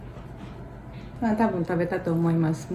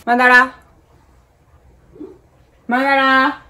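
calling the pet; 7 semitones higher, 6 dB louder than usual. Real, not acted.

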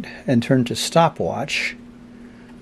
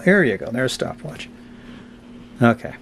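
normal talking voice

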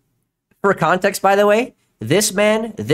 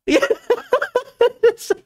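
high-pitched cracking-up laugh; helpless laughter. Real, not acted. Streamer cracking up at a clip, helpless high laughter. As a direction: burst out in high, breathless laughter.

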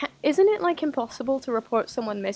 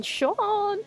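much higher loud call of "Sean!"; 10 semitones higher, 3 dB louder than usual. Acted. Gamer shouting at Sean in the game, high call. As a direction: yell the name, pitched high.

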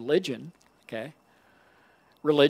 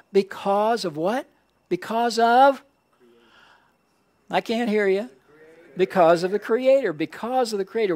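high, punchy prompts to the crowd; rousing call-and-response; 6 semitones higher, 4 dB louder than usual. Real, not acted. Preacher prompting a congregation for its answer, rousing call-and-response. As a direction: press the audience for the answer, pitched up.

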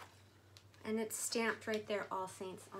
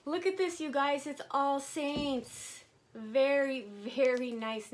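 loud, slow, drawn-out delight; excited showing off; 2 semitones higher, 5 dB louder, slower than usual. Real, not acted. Showing viewers the All Saints wallet excitedly. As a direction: show it off with a gasp of joy.

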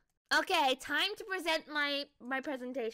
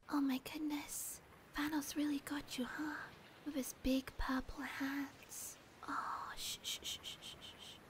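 whispered asmr role play; 10 dB quieter than usual. Pretend. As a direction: hush an injured hero gently. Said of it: "A S M R roleplay".

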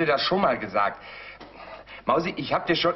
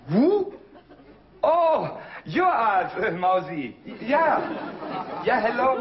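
aufgeregt; 5 semitones higher, slower than usual. Acted. Telefonat auf Englisch, aufgeregt.

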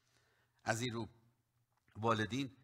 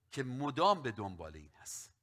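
usual speaking voice

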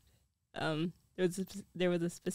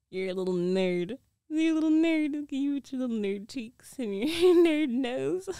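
louder, laughing mock taunt; loud playful teasing of a nerdy boyfriend; 4 semitones higher, 8 dB louder than usual. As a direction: crow it at him, teasing and laughing. Teasing him loudly as a nerd.

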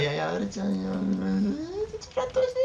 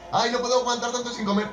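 higher whiny complaint; whiny anime character; 11 semitones higher than usual. Retold or imitated. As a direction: whine like a helpless anime hero. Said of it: "Que están siempre".

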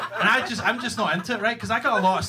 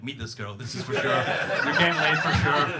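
penis voice; 5 semitones lower than usual. Retold or imitated. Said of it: "but my penis was like".

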